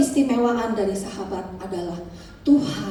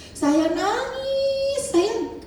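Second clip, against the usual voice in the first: stretched high; 12 semitones higher, much slower than usual. Retold or imitated. Recalling crying in prayer.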